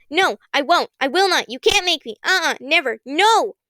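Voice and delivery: high, rapid string of stubborn noes; crazed defiant refusals. Acted. Constance hurls refusals at Kern, high and frantic. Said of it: "a crazed string of negatives".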